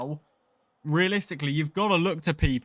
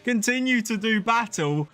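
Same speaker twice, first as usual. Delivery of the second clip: thrilled race caller, words stretched for drama; excited commentary; 4 semitones higher than usual. Real, not acted. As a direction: announce the rain with real, building excitement. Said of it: "hear the excitement in my voice".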